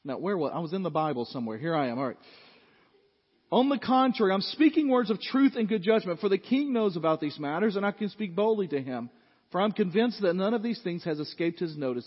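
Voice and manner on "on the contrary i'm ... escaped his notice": loud, high preaching of the text; booming pulpit reading; preaching scripture. Acted. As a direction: proclaim it to the whole church. Preacher reads scripture at full force.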